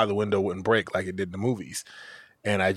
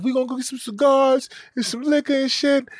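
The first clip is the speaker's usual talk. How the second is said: excited friend. Retold or imitated. Friend hyped that he's about to be a dad. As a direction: burst out thrilled for him. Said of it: "he's celebrating and whatnot".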